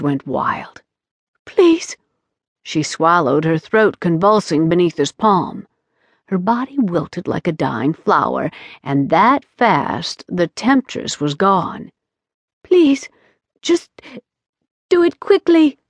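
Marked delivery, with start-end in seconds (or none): 1.4-2.0 high, frightened plea; pleading. Acted. Vampire faces the stake, terrified. 12.6-15.8 high, trembling resigned begging; desperate plea. Acted. Captured vampire begs for quick death.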